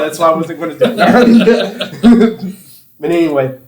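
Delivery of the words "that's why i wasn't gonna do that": embarrassed laugh through the words. Real, not acted. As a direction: laugh it off while talking.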